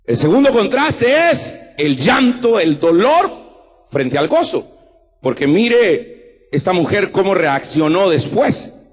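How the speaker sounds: ordinary talk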